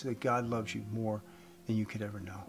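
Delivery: usual speaking voice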